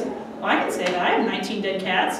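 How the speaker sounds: understated low male voice; boyfriend voice. Retold or imitated. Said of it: "And he goes".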